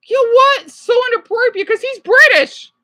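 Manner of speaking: loud, high-pitched indignation; indignant. Real, not acted. Viewer objecting to how the British man behaves, indignant. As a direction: burst out high and loud in protest.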